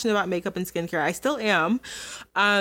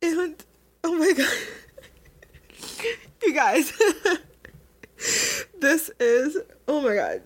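higher, teary laughing between words; tearful laughter; 6 semitones higher than usual. Real, not acted. Overcome while telling viewers about feeling a failure, teary laughter. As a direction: laugh through real tears. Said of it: "getting so emotional".

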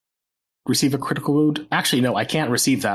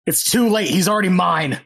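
higher-pitched, defiant outburst; acted defiant yell; 6 semitones higher, 3 dB louder than usual. Attacker answering the detective over the victim, defiant acted yell. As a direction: throw it back at them, voice raised.

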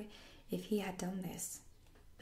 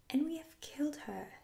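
hoarse; 8 semitones higher, 4 dB louder than usual. Acted. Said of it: "Nip said hoarsely".

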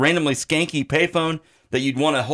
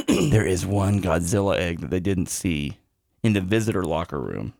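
hushed ominous storytelling; 8 semitones lower, 3 dB quieter than usual. Acted. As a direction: draw out the cliffhanger, low and quiet.

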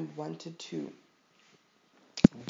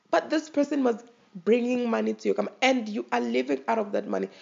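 higher and fast, protesting the boss's greed; indignant retort; 6 semitones higher, faster than usual. Retold or imitated. Retelling her protest to the rude employer, indignant. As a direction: fire back at the rude boss, quick and high. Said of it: "And I was like".

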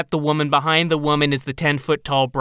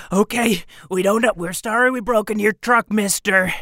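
panting runaway; 6 semitones higher than usual. Acted.

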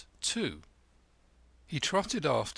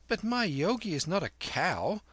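a hint of a grave boy, higher; mock grave; 7 semitones higher than usual. A narrator's reading. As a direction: play serious like a solemn child. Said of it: "said Kim gravely".

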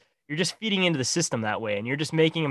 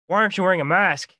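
put-on slurred drunk voice, higher and louder; town drunk; 5 semitones higher, 4 dB louder than usual. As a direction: slur it like a local drunk. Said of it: "Slurring his words, of course, ve-, very bad".

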